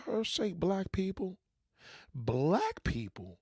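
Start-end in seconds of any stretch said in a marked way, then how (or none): 2.1-3.4 incredulous echo. Shown as a demonstration. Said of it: "did I just hear".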